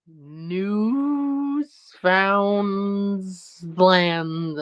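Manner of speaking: "Newfoundland" drawn out very slowly, letter by letter; spelled-out reading. Shown as a demonstration. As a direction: read "Newfoundland" exactly as misspelt, stretched. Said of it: "say that exactly how you wrote it".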